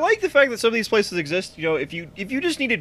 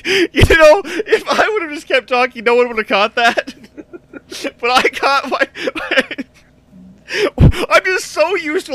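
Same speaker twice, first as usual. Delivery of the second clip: laughing; 7 semitones higher, 6 dB louder, slower than usual. Real, not acted. Laughing at own slip.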